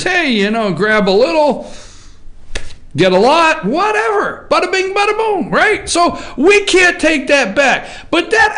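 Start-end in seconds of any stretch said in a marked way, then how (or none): 0.0-5.5 mock president. Host quoting the president bragging, high and mocking. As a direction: ham up a boastful president. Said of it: "he says".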